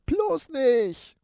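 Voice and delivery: high, loud squealing rat; terrified rat shriek. Acted. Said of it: "kreischt die Ratte".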